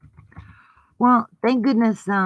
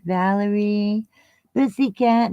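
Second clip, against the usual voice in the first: ordinary talk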